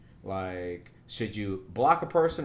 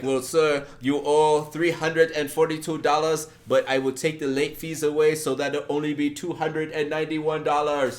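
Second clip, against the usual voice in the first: higher, louder officious agent; scam caller; 4 semitones higher, 7 dB louder than usual. Retold or imitated. Voicing the power-bill scammer, a touch higher and officious. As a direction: play the fake utility agent.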